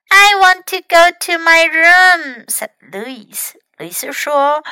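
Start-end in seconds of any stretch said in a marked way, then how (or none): 0.1-2.4 high, loud little-one voice; small child's voice. A narrator's reading. Hurt Louis orders Fox about, high small voice. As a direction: make demands in a high small voice.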